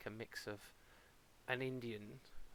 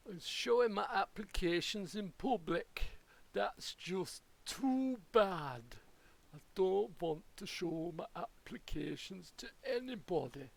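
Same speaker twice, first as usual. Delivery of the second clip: mock indignation; 5 semitones higher, 4 dB louder than usual. Mock-offended rant, far voice.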